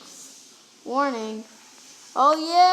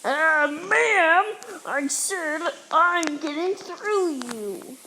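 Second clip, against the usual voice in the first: ordinary talk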